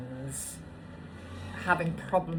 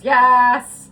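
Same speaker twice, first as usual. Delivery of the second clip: high, loud yeses; thrilled, loud yes yes; excited yes; 7 semitones higher, 16 dB louder than usual. Real, not acted. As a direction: burst out in joyful agreement. Excited about Lake Como.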